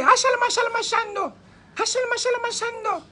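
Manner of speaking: high-pitched ecstatic gibberish; showy mock tongues, way up high; outburst of fake tongues. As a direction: leap up and cry out the rehearsed tongues for the church. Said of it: "make one jump up, people, and say".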